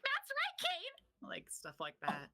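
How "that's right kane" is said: very high, shouted cartoon voice; high yelled character voice. Shown as a demonstration. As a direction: yell it way up high like a squeaky sidekick. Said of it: "I have to yell because he's always like".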